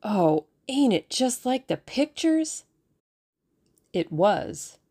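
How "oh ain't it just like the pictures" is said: higher and louder, slow and gushing; thrilled. Acted. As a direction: gush like a kid at the cinema. Boy thrilled by the story.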